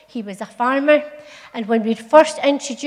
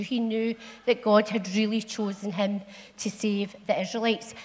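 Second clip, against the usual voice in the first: ordinary delivery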